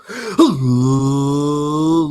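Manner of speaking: big loud theatrical gasp; dramatic gasp. Acted. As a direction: gasp in for the intro. Hyped gasp before intro.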